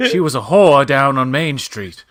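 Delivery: put-on loud old-timey Mid-Atlantic gossip; Mid-Atlantic accent. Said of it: "Mid-Atlantic is more like this".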